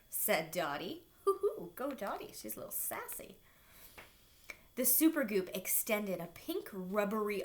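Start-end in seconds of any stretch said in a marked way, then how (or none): none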